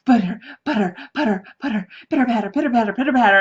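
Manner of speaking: steady patter that quickens; heartbeat imitation. Telling friends how their heart started beating, quickening heartbeat patter. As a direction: mimic a heart starting to race. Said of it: "My heart starts beating".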